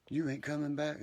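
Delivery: mimicking a relative asking if he's coming back; mocking retold question. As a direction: imitate the asker in a high, incredulous voice.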